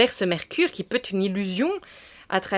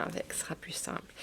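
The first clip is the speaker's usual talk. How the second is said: chuchoté; 3 semitones lower, 20 dB quieter than usual. Real, not acted. Aside before reading the text.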